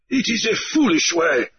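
high, stubborn objection; goat man. Acted. The goat man disputes Grief's promise, high and stubborn.

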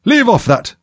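very high, shrill scream; screamed order. A narrator's reading. Queen stops the bowing gardeners, screaming. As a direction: scream for them to stop at once. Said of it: "screamed the Queen".

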